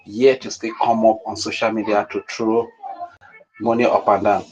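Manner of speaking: normal talking voice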